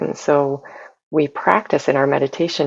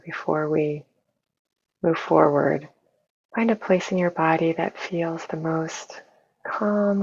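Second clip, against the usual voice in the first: soft, unhurried meditation voice with long pauses; hushed, even guidance for settling into the body; guided meditation; 6 dB quieter than usual. Real, not acted. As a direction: gently guide listeners inward, leaving space between phrases.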